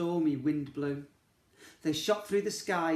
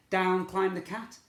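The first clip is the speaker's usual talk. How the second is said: plain reading voice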